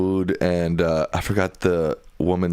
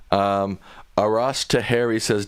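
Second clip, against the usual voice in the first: ordinary delivery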